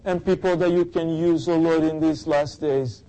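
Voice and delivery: high, insistent praying voice; fervent prayer. Real, not acted. Pastor praying aloud for the congregation, fervent. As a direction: plead to God in repeated, rising appeals.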